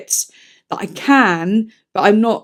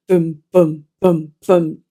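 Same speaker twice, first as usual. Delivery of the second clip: drum sounds; 4 semitones lower, slower than usual. Retold or imitated. Imitating hammering drum mid-quote.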